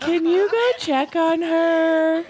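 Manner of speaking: put-on high, slow child's request; imitated kid. Child asking the teacher to check the guinea pig, imitated kid voice. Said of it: "One of the kids came and told me".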